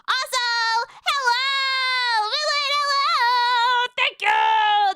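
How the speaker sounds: high, long-held hellos, almost sung; effusive raid welcome. Real, not acted. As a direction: stretch every greeting out in a big excited call. Greeting an arriving raid of viewers.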